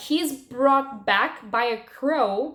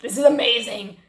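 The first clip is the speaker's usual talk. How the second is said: mock gleeful delight; 4 dB louder than usual. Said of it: "but not in the way like".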